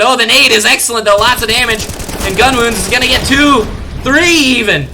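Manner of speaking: rising, loud play-by-play hype; excited call. Real, not acted. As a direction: ride the multi-kill as it lands. Multi-kill call in live cast.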